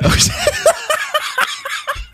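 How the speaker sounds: squealing, breathless laughter; high shrieking laugh. Real, not acted. Laughing at the drawing.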